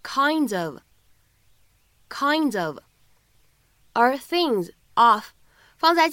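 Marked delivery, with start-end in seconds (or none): 0.0-2.8 kind of blended into kin-dof; linked reading. Shown as a demonstration. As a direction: link kind straight into of. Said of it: "可以读成kinds of". (kind of 连读)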